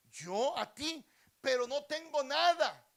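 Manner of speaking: high, very loud acted objection; loud protest. Beggar telling the king he has nothing, loudly protesting.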